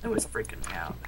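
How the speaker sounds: hushed, scared whisper. Real, not acted. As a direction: breathe it out, spooked. Scared by a nearby zombie.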